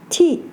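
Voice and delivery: "ti" falling sharply from high; fourth tone. Shown as a demonstration. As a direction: drop "ti" briskly from the top. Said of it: "but have different tones".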